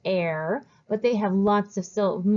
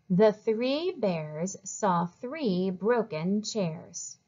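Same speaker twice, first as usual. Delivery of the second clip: slow, smoothly connected reading; fluent read-along; much slower than usual. Shown as a demonstration. Teacher reads with young readers, slow and fluent. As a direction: read it slowly and fluently for others to follow. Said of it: "Read it nice and fluently".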